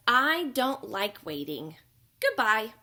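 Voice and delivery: fed up and flying off; grumpy egret. Acted. Egret tells the panda he won't wait, loud and grumpy.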